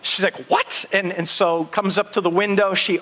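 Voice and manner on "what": high, sudden startled question; startled disbelief. Retold or imitated. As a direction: imitate a wife hearing absurd news. Wife told husband is on roof.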